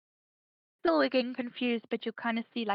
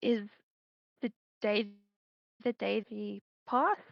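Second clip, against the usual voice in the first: sheepish. Acted. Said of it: "Oren again is gonna sheepishly ask".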